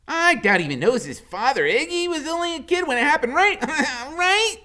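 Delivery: high, loud chatter; high giddy woman. A narrator's reading. Loretta guessing to Iggy, high and loud. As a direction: gossip eagerly.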